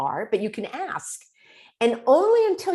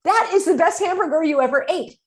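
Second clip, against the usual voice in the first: high, loud delighted echo; excited; 10 semitones higher, 5 dB louder than usual. Shown as a demonstration. Coach echoing a friend's food joy, high and loud. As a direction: share the hamburger joy, pitched way up. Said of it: "And you say".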